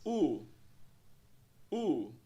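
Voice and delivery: "U" as a long OO vowel; isolated, sustained model vowel "U"; U sound. Shown as a demonstration. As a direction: say "U" like the OO in "too". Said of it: "que soa como U".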